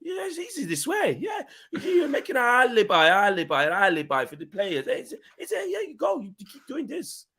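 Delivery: mock Conte in an Italian accent, cracking up; Conte's Italian accent. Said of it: "Terrible accents".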